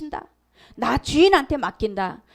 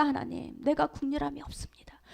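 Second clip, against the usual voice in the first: whispered confession; 11 dB quieter than usual. Retold or imitated. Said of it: "꼬꾸라진 거예요".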